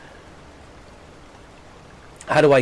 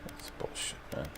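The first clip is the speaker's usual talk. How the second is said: whispered grumble; 22 dB quieter than usual. Real, not acted. Muttering after long silence.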